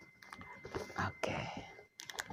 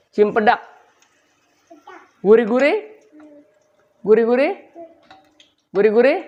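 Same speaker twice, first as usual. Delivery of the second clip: put-on high, playful little-kid voice, pitched up; child's voice; 8 semitones higher than usual. Grandpa voicing his grandchild's lines, high and childlike. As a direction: speak for the child in a high, eager voice.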